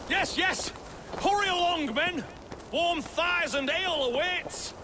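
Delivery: high, hearty rallying call; rally the men like a proud leader; rousing commander. Pretend.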